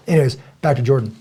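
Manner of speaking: ordinary delivery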